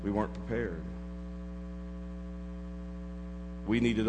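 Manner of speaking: preacher hushing the congregation at the grim turn; hushed sermon storytelling. Acted. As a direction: draw them in close with a near hush. Hushed climax of the illustration.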